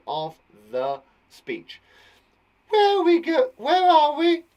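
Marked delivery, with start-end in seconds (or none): 2.7-4.4 very high, loud questioning; Jenny. Acted. Jenny asking where they are, very high loud voice. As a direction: wail the question in a high put-on voice. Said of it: "asked Jenny".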